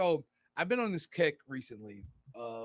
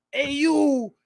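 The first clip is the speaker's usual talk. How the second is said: loud, high Italian-American holler; exaggerated Italian-American accent; 13 semitones higher, 16 dB louder than usual. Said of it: "gets very exaggerated and over".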